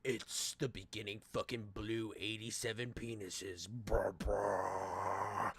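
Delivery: low, slow dramatic narrator voice; epic trailer narrator. Pretend. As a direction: growl it like a battle movie trailer. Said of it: "the music in this makes me sound like I'm gonna fucking fight this manga".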